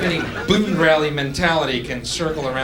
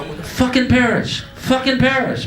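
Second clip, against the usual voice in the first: mock shouting spirit; 10 semitones higher, 4 dB louder than usual. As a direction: call it out from the spirit realm. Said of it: "spirit parents conceiving him".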